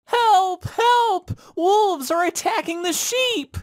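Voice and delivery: high-pitched frightened cry for help; yelling out. Acted. Shepherd boy yelling to the village for help, high. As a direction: call for help as the wolves close in. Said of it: "He yelled out".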